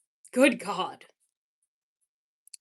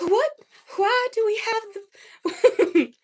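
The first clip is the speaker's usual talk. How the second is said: high, loud mid-Atlantic put-on; mid-Atlantic accent; 11 semitones higher, 5 dB louder than usual. Shown as a demonstration. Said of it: "What should I say in a mid-Atlantic accent?".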